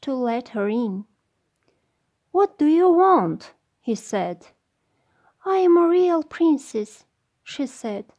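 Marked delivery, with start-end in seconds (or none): 2.3-3.5 high, loud startled prince; raised prince voice. Acted. Prince answers the door. 5.4-7.1 high, loud princess voice; cartoon princess. Princess at the door.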